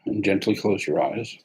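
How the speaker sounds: calm meditation guide, long pauses between phrases; guided meditation. Acted. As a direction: ease them gently into the sitting posture.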